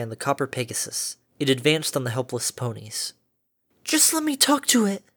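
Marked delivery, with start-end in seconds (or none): 3.8-5.0 high timid murmur; murmured plea. A narrator's reading. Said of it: "Fluttershy murmured and trembled".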